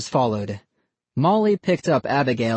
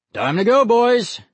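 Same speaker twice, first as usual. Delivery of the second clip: raised, higher dad call; 10 semitones higher, 5 dB louder than usual. A narrator's reading. Dad tells his sons it is time to go, calling out. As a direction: call the kids to leave.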